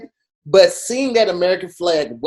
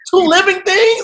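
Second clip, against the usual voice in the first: retold outrage; 13 semitones higher, 5 dB louder than usual. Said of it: "But then I was like".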